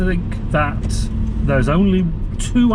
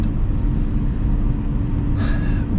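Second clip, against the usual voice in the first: long breathy sigh; exasperated sigh. Real, not acted. Vlogger fed up with Americans' bad accents, exasperated sigh. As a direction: let out a long, weary sigh.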